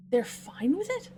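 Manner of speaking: slow question, rising on "it"; incredulous question. Real, not acted. Player questioning the characters' reaction, incredulous. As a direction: ask it in real disbelief.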